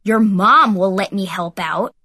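loud, slow comeback; snapped retort. A narrator's reading. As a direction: fire back, leaning hard on MOM. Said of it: "Heath snapped".

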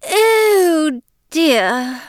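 a touch of dismay in the high fake-British voice; high put-on British sigh of mild worry; mildly miserable faux-British. Scene: Eliza frets at meeting Jane unintroduced, mildly dismayed fake British. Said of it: "Eliza said miserably".

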